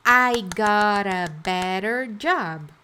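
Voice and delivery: chunk by chunk, pausing before the last word; I got a better, then a beat, then job; spoken slowly for students to repeat; slow drill. Shown as a demonstration. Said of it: "Então, vamos lá".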